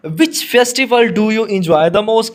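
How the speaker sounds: slow, deliberate dictation pace; slow reading aloud. Shown as a demonstration. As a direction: read the question slowly for students. Teacher reads question slowly.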